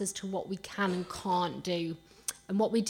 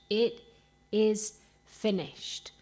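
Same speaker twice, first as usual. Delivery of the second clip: "It is finished" slow and louder, word by word; emphatic declaration; 4 dB louder, much slower than usual. Real, not acted. Preacher declaring the cross's work done, "It is finished" slow and emphatic. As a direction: land hard on each word of "It is finished", pausing after "It".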